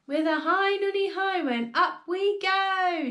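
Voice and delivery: high, drawn-out hoisting cry; gleeful shout. A narrator's reading. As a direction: sing out as you shoot upwards. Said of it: "she shouted".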